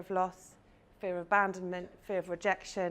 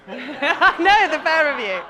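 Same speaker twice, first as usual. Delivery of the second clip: much louder, laughing at the pair; laughing tease; 5 semitones higher, 14 dB louder, much slower than usual. Real, not acted. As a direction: tease them through a laugh. Teasing two audience members.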